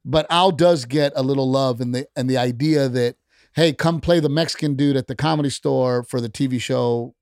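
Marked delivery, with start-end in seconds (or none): none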